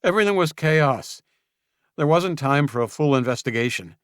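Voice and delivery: high, loud sharp retort; snapped. Acted. Julian snapping at Luke under pressure, loud and sharp. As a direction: bite back, composure slipping. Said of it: "Julian snapped".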